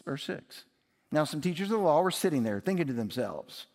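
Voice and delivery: ordinary talk, nothing marked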